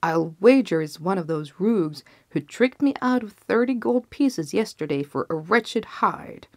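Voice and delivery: plain reading voice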